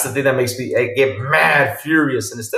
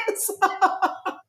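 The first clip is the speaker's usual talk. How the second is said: high squeaky laugh breaking the words; cracking up while finishing the line; squealing laugh; laughing through words; 5 dB quieter than usual. Real, not acted. Laughing mid-sentence.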